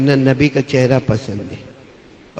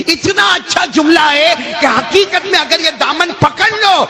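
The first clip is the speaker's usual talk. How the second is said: shout; 13 semitones higher than usual. Real, not acted. Preacher shouting to the gathering, fervent. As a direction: cry it out to the crowd.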